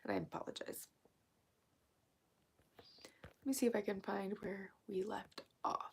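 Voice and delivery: soft whisper with vocal fry. Real, not acted. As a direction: keep it hushed so nobody hears. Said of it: "trying to keep kinda quiet".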